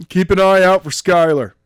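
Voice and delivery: a cocky dude who says bro; surfer dude voice. A narrator's reading. The man with the tie asks Daniels, casual surfer bro. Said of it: "the man with the tie voice through the radio".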